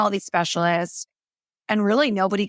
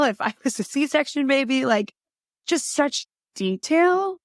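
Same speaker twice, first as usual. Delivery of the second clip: high-pitched wonder, slowing right down; amazed; 7 semitones higher than usual. Retold or imitated. Telling the host about the thorough doctor, high amazed wonder. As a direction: marvel at it, stretching out the detail.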